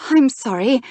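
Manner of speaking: flustered apology, high and loud. A narrator's reading.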